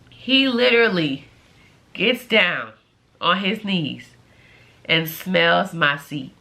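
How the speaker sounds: low, halting, deadpan retelling; word by word, appalled; grave disbelief. Real, not acted. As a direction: drop low and pause between phrases. Repeats the act in grave disbelief.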